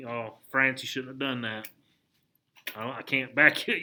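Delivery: low, subdued mock embarrassment; embarrassed Jefferson. Jefferson disowning what France did, acted out as quietly embarrassed. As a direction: sheepishly back away, voice dropped soft and low. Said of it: "Jefferson is kinda embarrassed".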